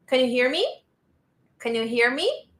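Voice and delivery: "Can you hear me" climbing sharply on "me"; weak "can", then lift "me" into a question; rising intonation. Shown as a demonstration. Teacher demonstrates yes-no question intonation to class, rising at the end. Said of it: "the intonation goes up".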